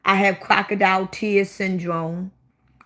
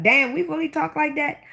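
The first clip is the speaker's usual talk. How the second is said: higher-pitched mock disbelief; surprised reaction; 7 semitones higher than usual. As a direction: question it in mild surprise. Voicing own surprised reaction to accent.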